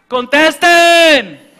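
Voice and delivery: high, loud drawn-out yell; yelled order. Retold or imitated. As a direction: bellow across the house to pick up the phone. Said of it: "Qué era lo que uno normalmente decía".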